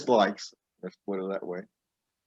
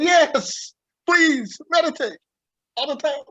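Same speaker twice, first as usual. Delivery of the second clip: much higher, thrilled voice; delighted higher self; 4 dB louder than usual. Acted. Higher self begging the listener to meditate, very high and delighted. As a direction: cheer it on like a joyful inner voice. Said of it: "Higher self goes".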